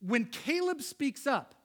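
normal talking voice

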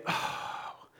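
soft, airy, drawn-out sigh; touched sigh. Acted. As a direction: sigh as if moved by devotion. Mock-touched sigh at Peter's devotion.

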